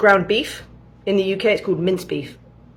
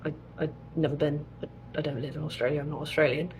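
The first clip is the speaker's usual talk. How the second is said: much softer, flustered protest; boyfriend's denial; 3 semitones lower, 13 dB quieter, faster than usual. Retold or imitated. Boyfriend telling Canadians he is not Australian, softly. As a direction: mumble it like a tired denial.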